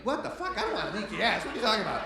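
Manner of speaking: loud, high-pitched comic outrage; incredulous high yell; outraged shout. Acted. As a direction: yell back at the TV in disbelief. Outraged viewer reacting to ad.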